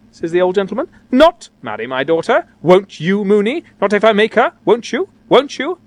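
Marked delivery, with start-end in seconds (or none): 1.1-5.8 climbing, incredulous questions; affronted father, rising in pitch; indignant questioning. A narrator's reading. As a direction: press harder with each question. Outraged father questions reluctant suitors.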